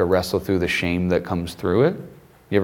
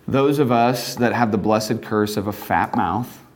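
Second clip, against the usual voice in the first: usual speaking voice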